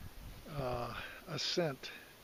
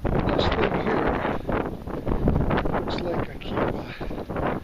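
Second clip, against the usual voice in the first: loud; 9 dB louder than usual. Real, not acted. Loud over wind on cliff.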